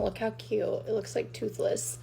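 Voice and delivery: hushed, whispered cooing over something cute; soft cooing. Real, not acted. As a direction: coo under your breath.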